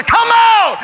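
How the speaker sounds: very high, loud command; mighty shout. Acted. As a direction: shake every grave with it. The Father's word raising graves.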